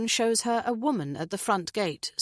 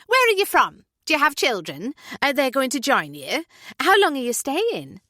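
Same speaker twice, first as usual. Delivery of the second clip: much higher, louder questions; rapid-fire nosy Julie; 7 semitones higher, 6 dB louder than usual. Acted. Julie quizzing the couple, loud and high. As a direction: pepper them with questions.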